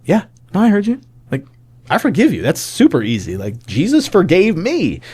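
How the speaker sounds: high-pitched, matter-of-course forgiveness; breezy reassurance. Retold or imitated. Breezily repeating forgiveness to wife.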